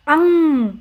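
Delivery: "अंग" hummed through nose and mouth; "अंग" as one long nasal sound; nasal anusvara. Shown as a demonstration. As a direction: let "अंग" ring on in the nose. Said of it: "नाक और मुंह दोनों से".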